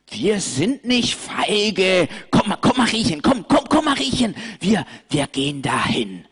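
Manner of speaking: high, insistent little lamb urging her friend; a small lamb proving she's brave; defiant lamb. Acted. Pauli insists to Mariechen they'll go, high and urgent.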